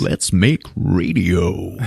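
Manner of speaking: slow radio host impression; Stephen McHattie. Quoting his favorite actor in Pontypool, velvet-voiced radio host. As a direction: draw it out like a velvet-voiced broadcaster. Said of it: "velvet-voiced".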